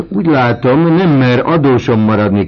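loud, high taunting call; shouted. A narrator's reading. Vautrin shouting his taunt at the student. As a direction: fling it out as a mocking shout. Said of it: "kiáltott Vautrin".

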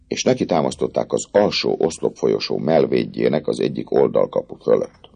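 ordinary delivery

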